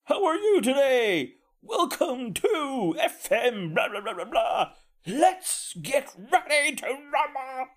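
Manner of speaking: hammed-up announcer, high and stretched out; mock the hyped-up radio host at full tilt; over-the-top radio DJ. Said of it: "he went from zero to a hundred".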